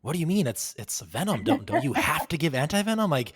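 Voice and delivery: high, loud mock disbelief; northern colleague exaggerating her surprise, high and loud; incredulous Northerner. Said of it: "us Northerners were like".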